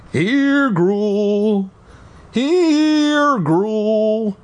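high, long-drawn pet call. A narrator's reading. Kid calling the lost creature, high and drawn out. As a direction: sing it out high, stretching each word. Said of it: "I even called out to it".

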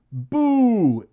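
high, loud, drawn-out boo; cry of boo. A narrator's reading. Mummy scaring the kids, loud playful cry. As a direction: jump out with a loud spooky cry. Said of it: "it cried".

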